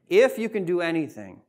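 lightly acted plea, falling away from "If"; imploring. Father puts his request to Christ, imploring. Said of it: "he implores Christ".

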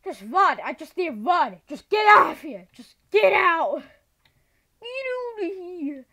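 yelling to escape; urgent shouting. Real, not acted. Fleeing mobs in panic.

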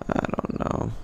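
breathy defeated whisper. Real, not acted. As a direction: whisper it to yourself. Repeats line, then whispers it.

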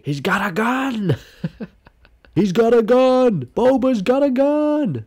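high, slow, rising excited shriek; excited squeal. Real, not acted. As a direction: shriek it out in delight. Excited reaction to meme image.

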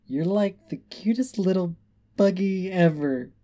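high, loud tipsy cooing; drunken, high-pitched adoration; cutesy gushing. Acted. Drunk pony gushing over her doll.

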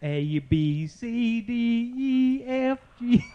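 an attempted British accent on the ABCs that slips toward southern. Asked to sing the ABCs, a wobbly British accent. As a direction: sing the alphabet in a put-on British accent, climbing in pitch. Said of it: "sing the A B Cs in your best British accent".

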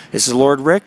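normal talking voice

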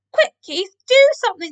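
high, loud plea; urgent cry. A narrator's reading. Cats up the tree begging Keith, crying out. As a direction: cry out for help in alarm. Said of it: "they cried".

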